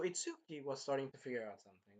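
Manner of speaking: ordinary talk, nothing marked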